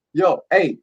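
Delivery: normal talking voice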